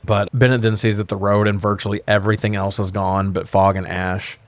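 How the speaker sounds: ordinary talk, nothing marked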